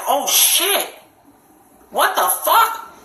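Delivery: high-pitched, drawn-out disbelief; high incredulous retelling of alarm; shocked. Retold or imitated. Retelling own shocked reaction.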